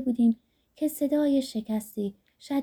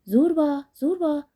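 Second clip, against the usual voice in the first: high, raised call of a name; crying out; 6 semitones higher, 5 dB louder than usual. A narrator's reading. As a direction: call out urgently for a missing friend. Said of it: "فریاد برآوردم".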